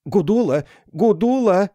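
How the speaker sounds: calling out. A narrator's reading. Calling her name.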